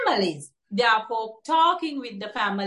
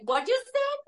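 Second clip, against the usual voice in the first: high-pitched retold comeback; imitating a knee-jerk reply, pitch jumping up; impulsive retort; 13 semitones higher than usual. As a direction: fire back an instant, high questioning retort. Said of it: "instantly reply back".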